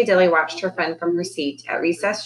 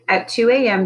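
usual speaking voice

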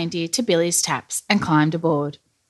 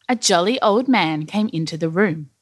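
ordinary delivery

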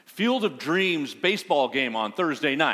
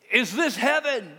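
calling to the crowd; 7 semitones higher, 5 dB louder than usual. Retold or imitated. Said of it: "he says".

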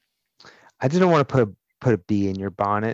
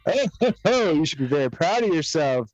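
game show host; 8 semitones higher than usual. Acted. Host praising contestant.

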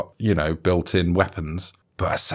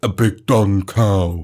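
slow drawl; slower than usual. Pretend. Drawn-out name.

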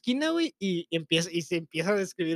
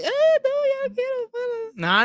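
mocking whiny cry, much higher pitch; burla de llanto; much slower than usual. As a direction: imitate a scared crybaby begging it to stop. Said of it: "Ese llanto de que". (imitando un llanto chillón y agudo, burlón)

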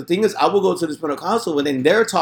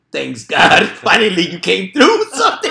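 laughing thanks; 5 semitones higher, 6 dB louder than usual. Retold or imitated. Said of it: "and I told myself".

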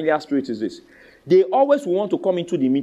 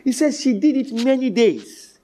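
ordinary delivery

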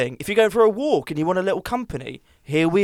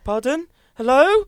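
mock call for attention; 10 semitones higher, 7 dB louder, slower than usual. Mocking the distracted friend.

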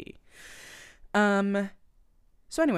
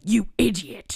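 louder mock scolding; angry coach imitation; 2 semitones higher, 5 dB louder than usual. Imitating the hot coach berating a player, mock anger. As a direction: punch it out as a mock insult. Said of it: "especially when he's like".

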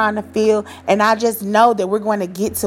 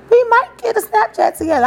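sudden burst, much higher and louder; excited idea; 14 semitones higher, 5 dB louder than usual. Real, not acted. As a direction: blurt it out like a new idea just hit.

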